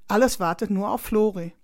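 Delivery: plain reading voice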